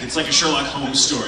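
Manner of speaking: ordinary talk, nothing marked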